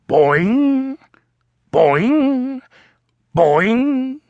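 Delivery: springy boing, high and bouncy; bouncy boing sounds. A narrator's reading. Narrator reading a picture book, bouncy high boing sounds.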